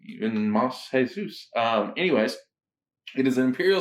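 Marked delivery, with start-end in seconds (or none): 0.0-1.5 slow "Even Mas Jesus", with "Jesus" as hay-SOOS; half-Spanish pronunciation. Shown as a demonstration. Host mixing languages for the beer name, slow "Even Mas Jesus" ending hay-SOOS. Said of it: "how do you say even in Spanish?".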